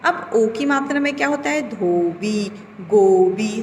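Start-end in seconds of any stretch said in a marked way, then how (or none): 1.7-3.6 धोबी, गोबी with a round ओ; teacher voicing the ओ words; clear ओ vowel. Shown as a demonstration. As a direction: stress the ओ in गोबी. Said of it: "सब में सुनाई दे रहा है आपको".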